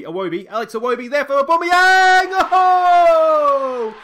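huge, high, roaring goal call; ecstatic goal shout. Real, not acted. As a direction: scream it out as the goal goes in. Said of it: "Oh, I've got too excited".

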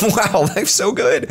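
slow, showy fake delight in life; mock carefree boast. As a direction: pretend you're having the time of your life. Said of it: "I could just be like".

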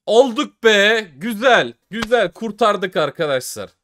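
high, loud shout of relief; real joy bursting out after the win; relieved cheer. Real, not acted. Vlogger finally wins back his money, shouting with relief.

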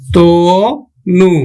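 Atanu stretched very slowly, ত as তো; drawn out. Shown as a demonstration. Teacher stretching the word for students, very slowly. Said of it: "আমরা উচ্চারণ করব কিন্তু".

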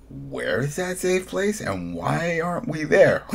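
high, slow, pointed demand; indignant mock question. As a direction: press her outrage, drawing it out. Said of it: "the follow-up would have been like".

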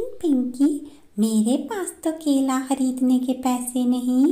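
high-voiced bird sister, regretful; लाचार बड़ी बहन. (लाचार दीदी की ऊँची आवाज़)

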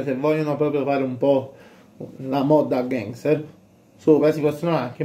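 speaking through fabric mask; through a balaclava. Real, not acted. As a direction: mouth covered by cloth.

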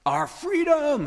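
high, loud battle cry; yelled. Retold or imitated. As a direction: hurl it at the troops like Wallace. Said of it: "he yells out".

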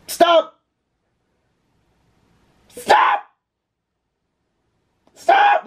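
short high-pitched scream of "stop"; imitated scream. Showing viewers how he was in bed, imitated scream. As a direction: shriek it like the screaming guy in a clip. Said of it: "where he screams every second".

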